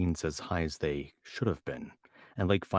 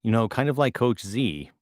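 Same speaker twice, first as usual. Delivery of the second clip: Minnesota accent; 5 semitones higher, 7 dB louder than usual. Shown as a demonstration. Comparing the accent to Coach Z, Minnesota accent. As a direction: keep the Minnesota accent going. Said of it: "doing a pretty accurate impression".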